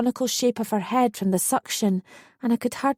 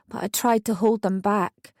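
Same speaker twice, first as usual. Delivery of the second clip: ordinary delivery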